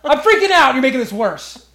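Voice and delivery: high, loud frantic complaint; hammed-up hysterics, yelled at the doctor; panicked shout. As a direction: blame them at full panic volume. Acts out yelling at the doctor.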